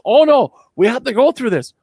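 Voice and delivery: high, loud mocking imitation of the boss; mocking Eileen. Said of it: "She goes".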